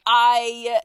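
ordinary talk, nothing marked

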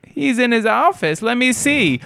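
put-on very high sweet old lady voice; high doting grandma voice; sweet old wife. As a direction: gush like a delighted elderly wife. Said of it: "she's like".

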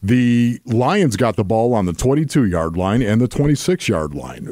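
ordinary talk

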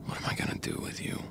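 breathless menacing whisper. Acted. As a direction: hush it under the breath, fast. Joe murmurs menacingly to Georgia.

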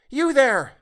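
put-on very high, clear girl's call; piercing young girl's shout; shrill and loud. A narrator's reading. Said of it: "voice clear and shrill and loud".

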